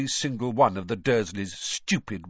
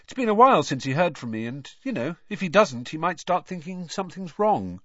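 higher-pitched, offhand chatter; casual; 6 semitones higher, 3 dB louder than usual. A narrator's reading. Nephew hinting godfather might worry, casually. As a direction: drop a veiled threat casually.

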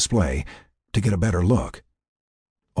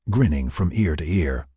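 ordinary delivery, nothing marked

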